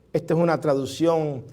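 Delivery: ordinary talk, nothing marked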